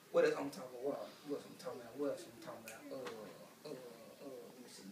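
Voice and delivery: under the breath; mumbling to self. Real, not acted. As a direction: trail off searching your memory. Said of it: "mumbling to himself".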